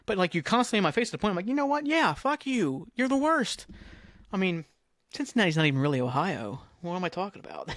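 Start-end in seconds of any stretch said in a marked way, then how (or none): 1.5-3.6 high-pitched, exasperated comeback; exaggerated, high "I've had it" voice; fed-up mock retort. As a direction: act out finally snapping at a pest, pitched way up. Acted retort to pestering fans.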